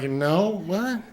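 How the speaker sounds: mock puzzled reply, rising high at the end; baffled customer. Customer to over-analyzing salesman, baffled and amused. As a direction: answer as if the question makes no sense.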